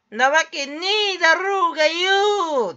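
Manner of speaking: high, loud drawn-out yell; comic outburst. Acted. Streamer shows how the video starts, comic yelling. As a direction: bellow the comeback, stretching the end. Demonstrates shouted opening of video.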